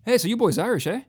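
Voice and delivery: high-pitched, cheery-lilting Canadian accent; put-on Canadian lilt. Shown as a demonstration. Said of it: "Say it in a real Canadian way".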